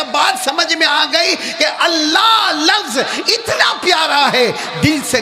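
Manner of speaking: sustained high, ringing delivery; impassioned testimony to the congregation; full-force preaching. Real, not acted.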